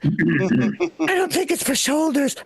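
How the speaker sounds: slow, put-on punchline voice; knowing mock voice. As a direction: draw it out slowly in a put-on voice. Voices reaction to old massager ad.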